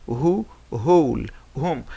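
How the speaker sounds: "who" and "whole" said with h only, no w; silent w demo. Shown as a demonstration. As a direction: say "who, whole" starting on a breathy h. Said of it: "W H as in who".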